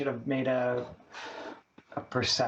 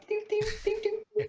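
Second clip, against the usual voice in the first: mock ding; faster than usual. Player mimics a sound.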